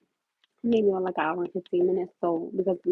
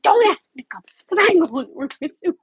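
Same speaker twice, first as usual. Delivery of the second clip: mock scolding mom; 10 semitones higher, 11 dB louder than usual.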